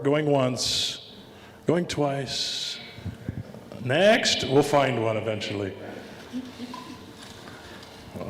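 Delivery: normal talking voice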